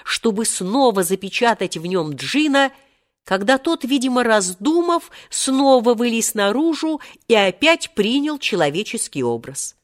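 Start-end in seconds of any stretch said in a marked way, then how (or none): none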